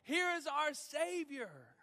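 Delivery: loud, high, driving sermon; full-force preaching. Acted. Pastor preaching to the church at full force. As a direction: preach it out with fire.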